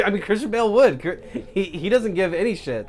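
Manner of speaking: amused, words bubbling out on a laugh. Real, not acted. Podcaster amused by co-host's question about the actor, laughing.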